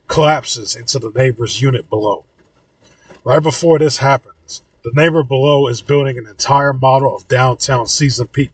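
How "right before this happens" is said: ordinary delivery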